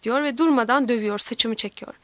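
ordinary delivery

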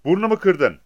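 high, loud cry of pain; pained shout. A narrator's reading. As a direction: yell out as if just hurt. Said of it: "acıyla bağırdı". (acıyla, tiz ve yüksek sesle bağırma)

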